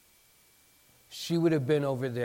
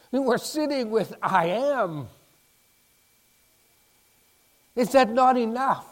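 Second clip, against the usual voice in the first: louder, higher, building sermon climax; fervent preaching; 6 semitones higher, 5 dB louder than usual. Real, not acted. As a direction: preach it with rising passion. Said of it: "preaching climax".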